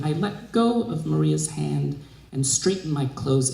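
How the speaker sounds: ordinary delivery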